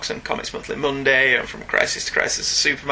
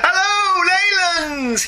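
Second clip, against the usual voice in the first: shouted greeting; slower than usual. Retold or imitated. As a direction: call the greeting out like the cheery letter writer. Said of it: "he begins".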